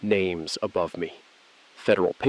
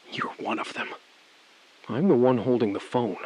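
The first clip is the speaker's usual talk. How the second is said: ordinary talk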